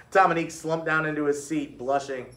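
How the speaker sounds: plain reading voice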